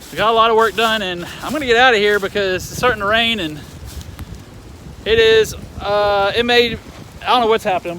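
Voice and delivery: loud and high over the wind; shouting over wind. Real, not acted. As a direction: yell to be heard in a storm. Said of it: "Sorry I'm having to scream".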